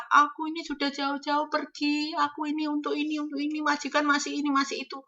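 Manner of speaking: tiruan keluhan pekerja, lebih pelan dan tinggi; mengeluh sedih. Retold or imitated. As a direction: merengek mengasihani diri. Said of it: "Jangan segitunya gitu loh". (pekerja baru mengeluh pada diri sendiri, pelan, tinggi dan merengek)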